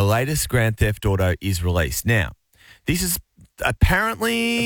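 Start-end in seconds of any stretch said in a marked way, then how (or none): 2.9-4.7 sceptical aside. Real, not acted. Sceptical aside, co-host echoes it.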